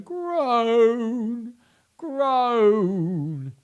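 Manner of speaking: high, loud wailing groan; hammed-up crocodile groan of toothache. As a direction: moan it out in pain. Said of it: "crying real tears, going".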